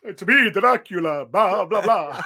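loud, slow hammed-up vampire; Dracula. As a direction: boom out a silly vampire catchphrase. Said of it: "He's not Dracula".

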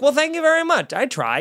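high, preening mock-modest thanks; a hammed-up flattered reply; flattered thank you. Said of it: "and that is like".

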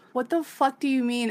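imitating her annoyed snap; mock angry Casca. Casca snaps back at Guts.

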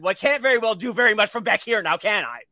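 loud, rapid mock exasperation; huffy father. Father to his son from the seat behind, mock huffy outburst. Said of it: "And the father said".

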